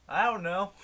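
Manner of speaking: loud, high-pitched clueless reply; imitated drunk man. Drunk kayaker questioned about his friend, loudly clueless. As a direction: holler it like a baffled drunk.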